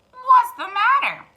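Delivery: high, loud rooster call; rooster asking. Acted. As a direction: crow the question down from the branch. Rooster Cocky Locky asks.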